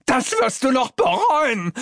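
high, shouted threat; furious high-pitched cry; angry yell. A narrator's reading. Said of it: "schreit er".

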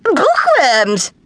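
put-on high, disgusted child's screech. A narrator's reading. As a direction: screech it in revulsion. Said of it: "screeched Belinda".

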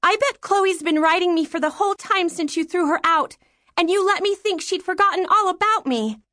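very high-pitched, hurt indignation; accusing child. Acted. Daughter accusing mother over sister's silence, high and indignant. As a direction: pour out the accusation as a wronged girl.

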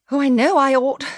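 high, agitated cry of regret; distressed cry. A narrator's reading. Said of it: "she cried".

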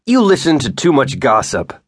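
curt, angry snap; snapped. A narrator's reading. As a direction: bite the words off at him. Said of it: "he snapped".

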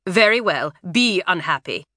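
usual speaking voice

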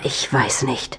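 unvoiced, hushed worry; whispering. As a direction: whisper so the boy can't hear. Parents talk quietly about son.

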